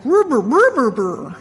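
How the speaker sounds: imitated dog grumble, rising then falling; dog whining. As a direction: make the dog's worried rumbling noises. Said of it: "my dog ... is, like, going".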